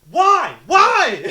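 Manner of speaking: high, loud repeated yell; furious coach. Retold or imitated. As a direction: scream it twice. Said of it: "And he goes".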